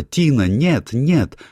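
high, loud shriek of alarm; mother's scream. A narrator's reading. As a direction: cry out to stop the child. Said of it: "Мать закричала". (испуганный крик матери)

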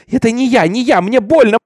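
high, loud panicked screaming; agonised cry. A narrator's reading. Beaten Minty protests it hurts, high loud cry. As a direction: cry out in pain and terror. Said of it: "Долгий крик разрезал воздух".

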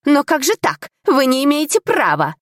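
high, indignant objection; protesting. A narrator's reading. Sacked tutor still resists the steward, protesting. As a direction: resist in helpless outrage. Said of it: "Всё ещё сопротивлялся бывший воспитатель".

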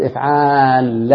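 افعال with a very long aa, then a double ل; long alif, doubled lam. Shown as a demonstration. Teacher showing a verb pattern, افعال stretched on aa into doubled ل. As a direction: hold the aa in افعال, land on doubled ل. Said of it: "الالف وبعد كده اللام المشددة".

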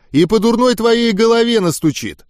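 high-pitched testy comeback; irritated retort. A narrator's reading. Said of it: "огрызнулся Оглоблин". (огрызаясь, высоким голосом)

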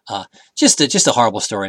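ordinary delivery

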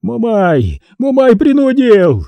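high, loud howling repetition; wailing. A narrator's reading. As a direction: keep wailing the same excuse under the lash. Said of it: "Никомат голосил".

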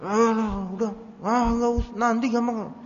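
lightly voiced groggy husband waving it off; mock annoyed mumble of a half-asleep man; grumpy brush-off. Sleepy husband brushing off his wife, mildly grumpy. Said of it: "Kita nggak sadar kan ngomong gitu".

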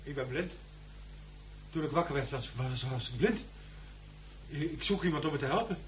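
hushed. Acted. Blind surgeon confesses softly.

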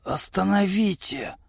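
faint, hoarse plea; wheezing. A narrator's reading. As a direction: gasp out an order with no strength. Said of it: "просипел наш лидер".

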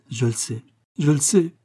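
acted distress, rising on the repeat; tearful. A narrator's reading. Agnès admitting it to her suitor, mildly tearful. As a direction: say it as if weeping harder. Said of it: "en pleurant encore plus fort".

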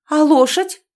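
loud high demand; insistent. A narrator's reading. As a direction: keep pressing jealously. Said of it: "не унималась Оленька".

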